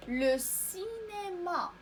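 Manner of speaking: "لو سينما" drawn out slowly, starting "سينما" on an s; model pronunciation. Shown as a demonstration. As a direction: stretch the "سي" of "سينما" with a clean s. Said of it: "تنطق س حيت متبوعة". (نطق "لو سينما" ببطء وبسين واضحة)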